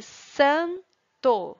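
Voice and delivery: "santo" as a loud, long "san", a pause, then "to"; split into syllables. Shown as a demonstration. Teacher giving pupils an example word, split into syllables. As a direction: stretch and separate san - to. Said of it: "esses sons são diferenciados".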